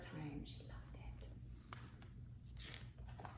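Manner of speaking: soft breathy whisper; gentle hush; tender whisper. A narrator's reading. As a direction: whisper it lovingly.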